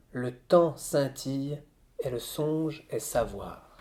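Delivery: slow, deliberate reading of verse; poem recitation. Acted. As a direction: savour the lines, unhurried and full. Recites the second stanza.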